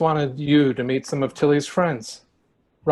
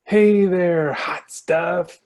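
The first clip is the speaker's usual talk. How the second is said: leering; 3 semitones higher, 5 dB louder, much slower than usual. Acted.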